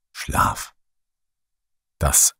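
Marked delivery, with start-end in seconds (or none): none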